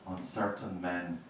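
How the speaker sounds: slow, grave elegy reading; poetry reading. Acted. Poet reads an elegy for her dead to the audience, gravely.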